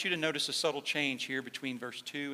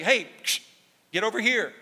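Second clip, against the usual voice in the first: louder, higher sharp shepherd's call; a firm, louder "get over here" like a correcting shepherd; 5 semitones higher, 7 dB louder than usual. Retold or imitated. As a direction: prod the sheep with your voice. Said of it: "the rod was meant to prod".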